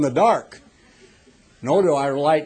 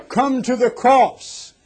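slow, higher, louder evangelist's appeal; Billy Graham; 9 semitones higher, 6 dB louder, slower than usual. Retold or imitated. Preacher imitates the evangelist's altar call, slow and higher. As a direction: draw out the call like a revival preacher. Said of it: "Hundreds of times I've heard him say".

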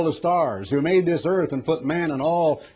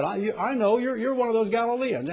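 higher, halting retelling of the accusation; stammering accusation; 8 semitones higher than usual. Retold or imitated. Preacher retells the second girl's accusation, higher and halting. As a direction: stumble through the girl's words. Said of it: "young lady came and said".

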